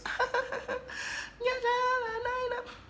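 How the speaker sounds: very high, put-on giggle; high-pitched fake laugh. Trying to be nice to the suitor, high fake laugh. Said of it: "I laugh or high pitch voice".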